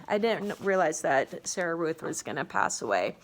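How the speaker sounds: ordinary delivery, nothing marked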